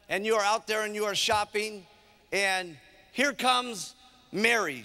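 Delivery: ordinary talk, nothing marked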